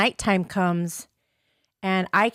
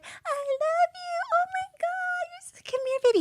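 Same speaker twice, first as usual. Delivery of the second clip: gushing ex. Retold or imitated. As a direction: imitate him wailing with relief and adoration. Voicing ex's gushing after she gives in.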